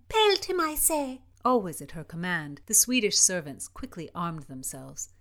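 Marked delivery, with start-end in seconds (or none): 0.1-1.2 shouted order. Acted. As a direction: egg the servants on. Princess orders servants to attack.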